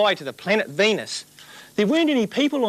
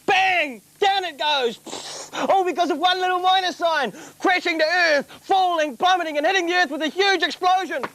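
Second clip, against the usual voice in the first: high, relishing every crash; gleeful excitement; 8 semitones higher than usual. Acted. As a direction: revel in the destruction.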